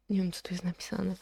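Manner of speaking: ordinary talk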